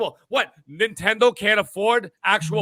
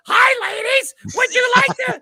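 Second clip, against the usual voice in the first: far higher and louder, a squeaky shouted squeal; squeaky ridiculous voice; 5 dB louder than usual. Retold or imitated. Mocking the wingman Titan greeting two women, squeaky and shrill-high. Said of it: "that squeaky, ridiculous voice".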